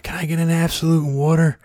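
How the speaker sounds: high, strained call over a noisy bar; shouting an order across a packed bar; mock yelled bar order. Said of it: "I'm screaming at the bartender".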